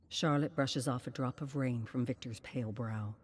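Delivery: usual speaking voice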